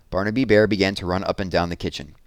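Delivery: ordinary delivery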